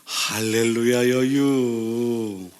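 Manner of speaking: very slow, drawn-out Chungcheong-style dialect; regional dialect. Acted. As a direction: stretch the final syllables like a slow country dialect. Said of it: "또 어느 쪽에서는".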